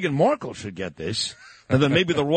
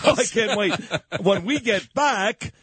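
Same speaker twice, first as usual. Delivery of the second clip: higher, talking through laughter; laughing; 5 semitones higher than usual. Real, not acted. Host to his reporter before the break, laughing. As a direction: keep going while cracking up.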